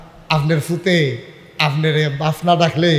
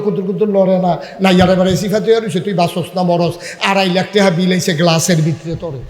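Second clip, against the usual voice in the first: usual speaking voice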